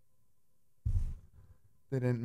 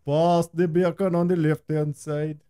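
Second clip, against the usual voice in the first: slow, higher singsong Jamaican accent; 4 semitones higher, 4 dB louder, slower than usual. Shown as a demonstration. Joining the partner's joke, hammed-up Jamaican accent. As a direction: play up a mock Jamaican accent. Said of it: "say it like you're Jamaican".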